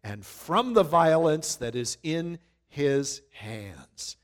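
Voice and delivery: slow, weighty reading of the decree; measured preacher reading; slow scripture reading. Acted. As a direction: dwell on every word.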